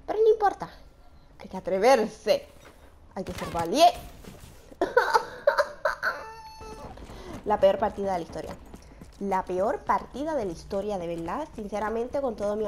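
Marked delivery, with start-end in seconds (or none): 4.7-7.4 breathy high laugh near crying; half-laughing half-crying breath; despairing laugh. Real, not acted. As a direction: laugh at your own failure. After terrible play.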